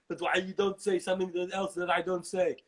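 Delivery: thick Austrian action-star grumble; aggrieved muscleman impression; Arnold Schwarzenegger. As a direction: demand it with a heavy Austrian accent. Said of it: "then Schwarzenegger pops up".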